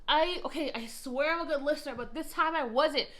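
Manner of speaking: defensive outburst. Real, not acted. Defending herself as listener.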